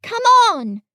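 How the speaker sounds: put-on high, loud boyish call; shout. A narrator's reading. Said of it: "a shout from the top of the line".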